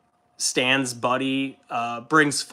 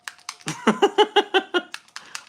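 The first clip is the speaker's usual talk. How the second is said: a burst of high, squeaky laughter; 4 dB louder than usual. Real, not acted. Laughing at the joke comment.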